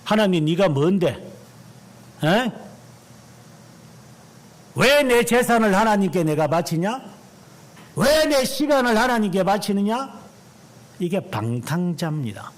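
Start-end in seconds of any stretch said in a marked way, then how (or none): 0.0-10.2 high, loud, indignant defiance; a wastrel mocking God, rising and loud; defiant sinner. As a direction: sneer back at God as the rebel. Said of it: "이 말입니다".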